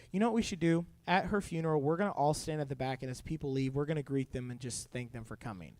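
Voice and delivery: low, soft funeral murmur, fading further; hushed family suggestion. Retold or imitated. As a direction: imitate a relative quietly proposing a plan.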